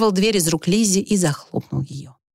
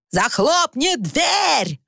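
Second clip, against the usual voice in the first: high, drawn-out bellow; furious stretched-out shout; yelling; 7 semitones higher, much slower than usual. A narrator's reading. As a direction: roar the order across the room. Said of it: "заорал Уимис".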